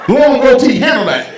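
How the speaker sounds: loud, high comeback at a heckler; yelling at heckler. Real, not acted. Comic answers woman yelling from audience, loud laughing shout.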